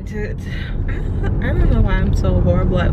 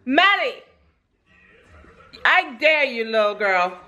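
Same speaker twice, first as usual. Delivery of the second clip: high scolding; 6 semitones higher than usual. Real, not acted. Mother scolds baby for hand in diaper, high and mock-disgusted.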